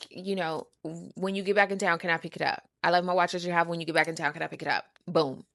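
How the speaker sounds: usual speaking voice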